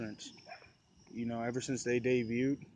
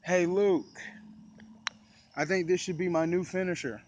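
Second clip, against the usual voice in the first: raised, louder wrestler's call; retold yell, pitched up; imitated yell; 6 semitones higher, 4 dB louder than usual. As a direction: call out to your partner across the ring. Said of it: "stood up and yelled out".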